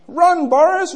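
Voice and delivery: high-pitched mock warning; mock urgent call. Talking tree warning a hiker, mock urgent call. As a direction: cry it out as a playful alarm.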